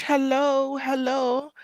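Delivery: high, rising cartoonish hello; sing-song hello. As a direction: chirp a bright greeting to each viewer. Sing-song greeting shout-outs.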